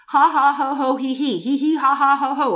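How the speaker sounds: loud rhythmic ha ha ho ho he he; laughing prayer. Shown as a demonstration. Preacher showing her church the laughing prayer, loud chanted laughter. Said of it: "however you want to do it".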